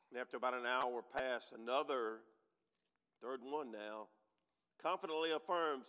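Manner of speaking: ordinary delivery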